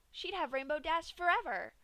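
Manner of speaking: usual speaking voice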